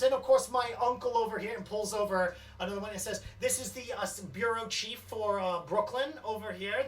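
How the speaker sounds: a touch of a proud cousin making introductions; introducing the family. Acted. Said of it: "He goes".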